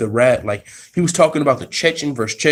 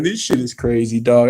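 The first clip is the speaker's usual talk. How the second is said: ordinary talk, nothing marked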